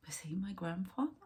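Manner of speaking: a hushed private wondering; whispered question. Real, not acted. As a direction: breathe the question out almost unvoiced. Asks the same question twice.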